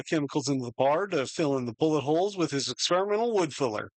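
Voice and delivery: usual speaking voice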